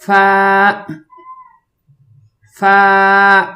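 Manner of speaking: touch lip to teeth, then hold فا level. Shown as a demonstration. Teacher showing learners the letter فا, lip-to-teeth and drawn out. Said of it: "نچلے ہونٹوں کو اوپر والے دانتوں سے ٹچ".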